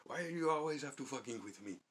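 high, loud imitation of the Yemeni clerk's accent, laughing through it. Clerk snapping at troublemakers, loud high accented impression. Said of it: "He'd be like".

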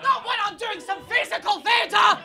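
high, stretched-out shout; mock desperate plea. Performer to someone leaving mid-act, mock desperate shouting. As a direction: cry out after someone walking away.

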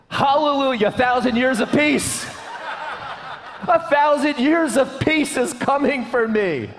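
gleeful mock-shouting wife; wife's joyful reply. Wife cheering at a marriage-free heaven, gleeful shout. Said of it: "You know what she said?".

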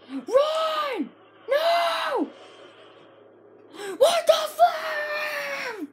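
alarmed shouting. Real, not acted. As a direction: shout the warning, then the outrage. Teammate about to die.

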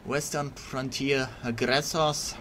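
Western Frontier aggressors in a mock Russian accent; rolling Russian accent, commanding. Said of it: "wannabe Russian voice acting impression by me".

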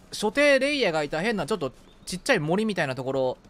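normal talking voice

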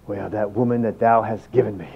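muttered, low mock complaint about the wife; grumbling husband. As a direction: grumble it under your breath like a sulking husband.

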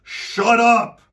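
loud, high-pitched yell; exasperated shout. Retold or imitated. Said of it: "take a break and just shout".

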